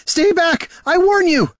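very high, loud warning; desperate high-pitched yell; panicked shout. A narrator's reading. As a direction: threaten them while terrified. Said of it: "he shouted".

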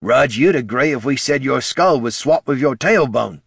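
high, loud character snap; irritated snap. A narrator's reading. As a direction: snap it out in exasperation. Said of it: "snapped Ned".

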